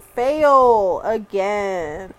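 every word slow and heavy, Fail again; slow emphatic. Retold or imitated. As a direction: read it like big bold letters. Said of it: "in big fat letters".

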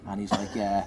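ordinary talk

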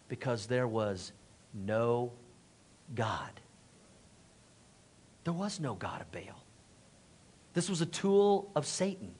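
low, near-whispered solemn verdict; very soft, sober preaching; hushed, grave. Real, not acted. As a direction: let it sink in with long pauses. Solemn verdict on the false god.

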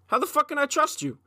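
high-pitched, incredulous challenge; indignant retort. Retold or imitated. Talking back to mom about trust, indignant. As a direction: throw the question back in disbelief, pitched high.

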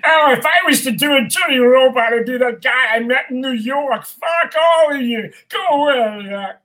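put-on grumpy old robot telling everyone off; dirty old robot. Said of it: "they asked for a dirty old robot".

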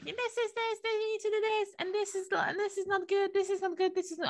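mock the boss who always moaned; moaning manager. A mocking imitation of the nagging manager or teacher. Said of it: "always used to just moan and be like".

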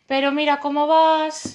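put-on loud, slow jeering at a drunk girl; mocking taunt. Men on the street jeering at Andrea, loud slow taunt. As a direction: heckle her slowly and loudly. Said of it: "empezaron a decirle".

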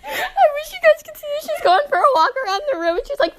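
high-pitched talking through laughter; laughing uncontrollably. Real, not acted. Describing friend collapsing with laughter.

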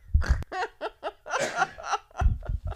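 high, breathy helpless laughter; real wheezing laugh. Real, not acted. Storyteller picturing spit on her shirt, wheezing laughter. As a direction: wheeze with laughter after the punchline.

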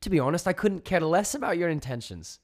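loud, high-pitched telling-off; indignant. A narrator's reading. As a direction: set them straight, indignant and emphatic. Joni angrily confronts the alien voice.

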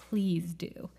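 soft whisper, almost breathed; hushed closing whisper; whispered plea. Real, not acted. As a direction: lean in and whisper it. Punchline to Maya Angelou quote.

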